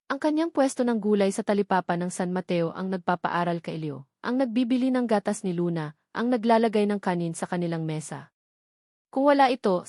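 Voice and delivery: ordinary delivery, nothing marked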